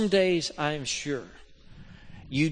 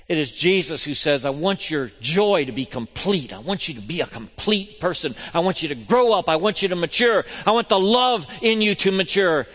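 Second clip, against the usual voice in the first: louder and higher with each "I want"; Jesus' promise preached at full force; building sermon quote; 5 semitones higher, 9 dB louder than usual. Acted. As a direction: climb toward a ringing declaration.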